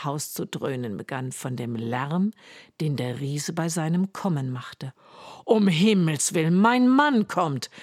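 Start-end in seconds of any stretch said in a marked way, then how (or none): 5.4-7.7 alarmed cry. Acted. Giant's wife hearing her husband come, alarmed cry. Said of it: "rief die Frau des Riesen".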